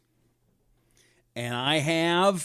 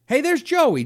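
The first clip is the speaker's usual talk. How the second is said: higher, louder happy call to a friend; excited greeting; 12 semitones higher, 6 dB louder than usual. Real, not acted. Host spots his former engineer in the chat, calling out gladly.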